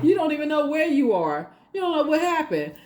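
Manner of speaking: high dizzy voice. Retold or imitated. Mocking dizzy confusion.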